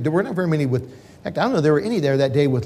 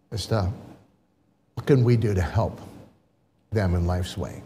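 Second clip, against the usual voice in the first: quiet prayer; 5 semitones lower, 5 dB quieter than usual. Retold or imitated. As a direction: pray it low and humbly. Couple prays in the car.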